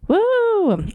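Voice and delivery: high whooping cheer. Real, not acted. Cheering for the character.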